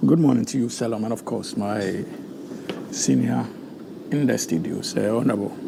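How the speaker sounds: low, weakened voice giving out; losing voice. Real, not acted. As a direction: greet them softly, low, as your voice fails. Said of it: "I'm losing my voice".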